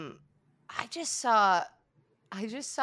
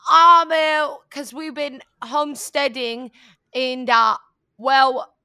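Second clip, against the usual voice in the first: loud hammy Boston pitchman; Boston accent; 7 semitones higher, 13 dB louder, slower than usual. Acted. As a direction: blare it in a cartoon Boston accent. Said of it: "the gamer voice".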